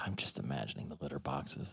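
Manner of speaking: ordinary talk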